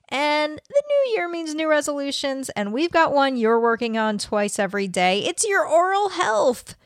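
a lightly upbeat sponsor read. Acted. Host segues to the sponsor, casual ad read. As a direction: sell the toothbrush, bright and easy.

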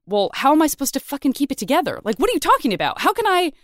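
high, fast, incredulous rant; exasperated outburst. Retold or imitated. Podcast host venting at a colleague, exasperated and high. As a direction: blurt it out in disbelief, voice climbing. Quoting hosts' heated exchange.

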